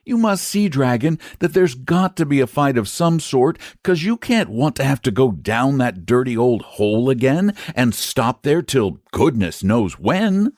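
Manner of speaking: put-on high boyish voice, earnest; an eager lad reasoning with a dragon; boy persuading his friend the dragon; boy pleading. A narrator's reading.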